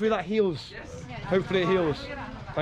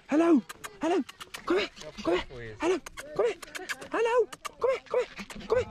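calling a dog; 12 semitones higher, faster than usual. Real, not acted.

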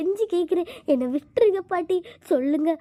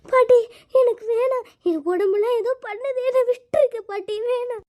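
high panicked begging; scared pleading; 7 semitones higher than usual. Acted. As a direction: plead with the old midwife, voice up high. Pleading with the midwife. (பயந்து உயர்ந்த குரலில் கெஞ்சுதல்)

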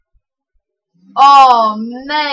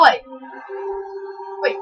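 ordinary talk

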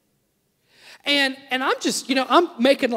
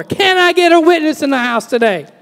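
louder, higher call to the crowd; preacher's rallying call; 5 semitones higher, 6 dB louder than usual. Acted. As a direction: rouse the room like a preacher. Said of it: "calling on congregation".

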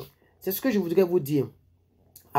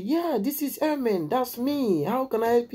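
a high, slow put-on woman's voice; mock high lady voice; 9 semitones higher, slower than usual. Imitating the recruiter on the phone, high and slow. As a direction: play the lady on the phone, lightly. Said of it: "elle dit".

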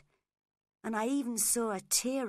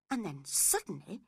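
ordinary delivery, nothing marked

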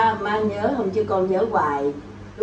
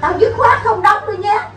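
high, slow, emphatic mock protest; imitating mother's refusal; 9 semitones higher, 4 dB louder, slower than usual. Actress quoting mother swearing off the role, high mock protest. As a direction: put your foot down, much higher. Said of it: "má nói".

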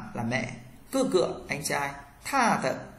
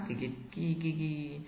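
ordinary talk, nothing marked

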